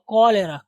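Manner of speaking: कॉलेरा with the opening KO brought out; correct pronunciation. Shown as a demonstration. Teacher pointing out the first sound, clear KO in कॉलेरा. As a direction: hit the KO of कॉलेरा, then le-ra. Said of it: "लेकिन यहाँ पे कॉ".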